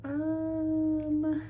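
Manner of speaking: high, loud, drawn-out stalling sound; acted hesitant um. Woman reacting to a cemetery invitation, exaggerated hesitation. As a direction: stretch it out high, as if lost for an answer. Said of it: "And she was like".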